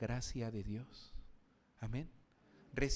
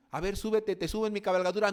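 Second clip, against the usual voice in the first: Samaritan offering; 6 semitones higher, 7 dB louder, faster than usual. Acted. Acted Samaritan offering help.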